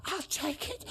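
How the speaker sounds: very high, squeaky mock panic; hammed-up scared kid, pitch shooting up; imitating panicked kid. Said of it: "I thought the kid was gonna pass out".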